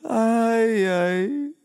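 soft pained groans after the dentist; dopey, fading ouch; groggy moaning. Real, not acted. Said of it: "E tá doendo". (gemendo grogue de dor)

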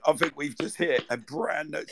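normal talking voice

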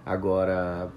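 ordinary talk

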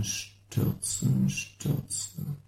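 dying away into a whisper; sinking into silence; fading whisper. Acted. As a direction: breathe the word out until it vanishes. Sound poem, words sinking away.

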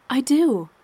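emphatic reassurance; immediate insistence. A narrator's reading. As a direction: insist at once, pitch lifted. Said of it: "she said immediately".

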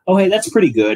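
high, loud, bright "oh hey"; mock impressed. Miner pleasantly surprised by the talks, high and loud. Miners react, acted quote.

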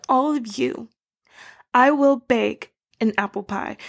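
ordinary talk

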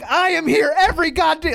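imitated high-pitched meltdown; Seth Rollins. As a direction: act out losing it, pitch way up. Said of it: "he just lost it".

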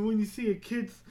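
ordinary talk

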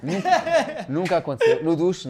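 laughing through the words. Real, not acted. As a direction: laugh while denying it. Denies singing together, laughing.

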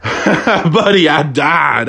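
laughing hard at the memory. Real, not acted. Friend cracking up at his buddy's post. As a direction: laugh through the word.

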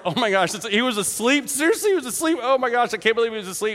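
rising, astonished questioning; astonished listener. Acted. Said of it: "and Mark's like".

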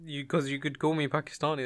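ordinary talk

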